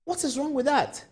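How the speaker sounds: pointed question rising high; devil's advocate. Acted. Anchor challenging viewers as devil's advocate, pointed. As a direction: press viewers to defend their view. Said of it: "I'm just playing the devil's advocate".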